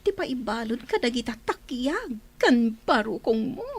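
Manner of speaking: ordinary talk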